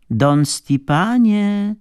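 high, drawn-out call from downstairs; slow, high, singsong summons. A narrator's reading. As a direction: stretch the name out like a woman calling up the stairs. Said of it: "rozległ się raptem ... dobrze mu znany głos".

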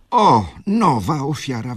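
high, loud mocking outcry; loud exclamation. A narrator's reading. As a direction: exclaim in scandalised outrage. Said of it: "wykrzyknęła baronowa". (głośny, oburzony wykrzyk)

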